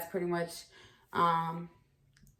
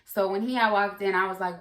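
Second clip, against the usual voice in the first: usual speaking voice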